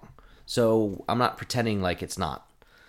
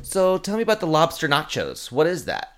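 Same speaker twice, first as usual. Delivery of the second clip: higher, eager puzzled customer; clueless customer; 6 semitones higher, 4 dB louder than usual. Retold or imitated. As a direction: act out a baffled diner. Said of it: "this woman says".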